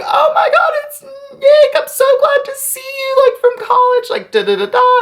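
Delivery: very high, squeaky mock wail; hammed-up tearful gushing at an old friend; imitated drunken crying. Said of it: "she's, like, crying".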